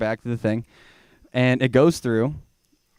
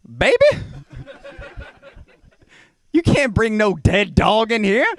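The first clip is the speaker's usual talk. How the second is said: higher, louder sassy agent; TSA agent voice; 9 semitones higher, 3 dB louder than usual. Retold or imitated. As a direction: scold him like a no-nonsense airport agent. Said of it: "But she goes".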